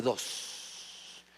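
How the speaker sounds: whispered dos, breathy and unvoiced. Pastor whispering the punchline of the second mile, unvoiced.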